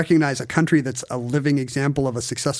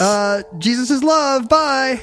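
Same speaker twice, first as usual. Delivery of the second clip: cheery spirit voice; 8 semitones higher, much slower than usual. Shown as a demonstration. Joins heavenly spirit bit.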